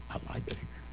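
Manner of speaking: nearly whispered; quiet. Real, not acted. Pastor answering classmates, nearly whispered. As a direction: say it softly to yourself.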